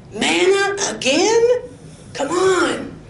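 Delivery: mock grumbling at the manna; complaining Israelites. Israelites complaining about manna, whiny and high.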